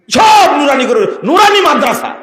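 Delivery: সব নুরানি করে shouted high in scorn; sarcastic outcry. Real, not acted. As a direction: ridicule the word Nurani. Mocking the label nurani. (বিদ্রূপ করে উঁচু গলায় চিৎকার)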